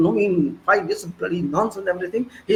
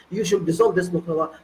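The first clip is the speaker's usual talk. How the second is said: usual speaking voice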